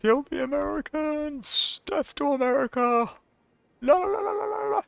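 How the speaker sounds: very high, far-off squeaky voice; squeaky high war cry. Pretend. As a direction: squeal it like a tiny maniac. Cheering on Mexico's attack.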